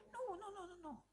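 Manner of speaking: high, soft half-crying no; tearful protest. Retold or imitated. Sister begging them not to open the tomb, half crying. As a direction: whimper the refusal.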